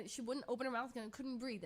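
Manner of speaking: normal talking voice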